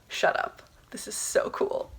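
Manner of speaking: hushed, disbelieving whisper; awed whisper. Retold or imitated. Student recalls seeing the money land in her account, awed whisper. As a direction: whisper it slowly, amazed at the luck.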